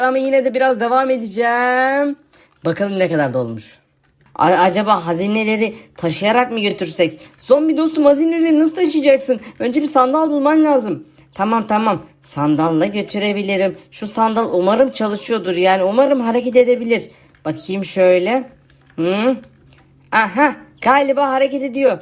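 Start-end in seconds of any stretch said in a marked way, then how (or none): 2.6-7.2 lightly lowered, lazy zombie voice; zombie friend voice. Acted. YouTuber voicing his zombie friend for viewers, lightly lowered zombie voice. 11.3-19.4 lightly lowered, lazy zombie voice; zombie friend voice. Acted. YouTuber voicing his zombie friend for viewers, lightly lowered zombie voice.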